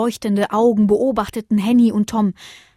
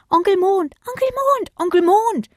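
hohes, lautes Rufen; rufend; 12 semitones higher, 4 dB louder than usual. A narrator's reading. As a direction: ruf nach dem Mond hinauf. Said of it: "riefen die Sterne". (Sterne rufen laut nach dem Mond)

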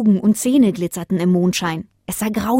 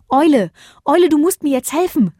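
louder, higher call to the owl; 7 semitones higher, 6 dB louder than usual. Acted. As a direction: call up for help. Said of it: "rief Bodo".

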